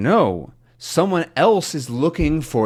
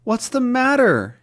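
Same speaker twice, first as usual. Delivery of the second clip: shocked question; 8 semitones higher than usual. Acted. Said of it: "he asks".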